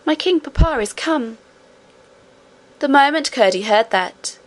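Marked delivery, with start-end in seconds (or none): 0.0-1.4 joyful. A narrator's reading. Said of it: "clapping her hands right joyfully".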